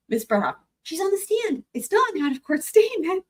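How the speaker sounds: imitating Elaine, insistent. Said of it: "my Elaine voice".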